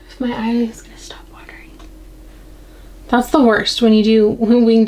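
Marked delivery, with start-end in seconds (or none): 0.1-1.7 under-breath whisper to herself. Real, not acted. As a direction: murmur it while concentrating. Muttering while concentrating.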